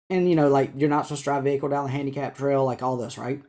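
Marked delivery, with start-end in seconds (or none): none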